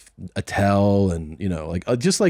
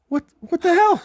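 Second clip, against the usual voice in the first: very high-pitched disbelief; comically appalled squeal of a question; retold shock; 3 dB louder than usual. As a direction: play aghast, voice shooting up. Retold shock after the cursing set.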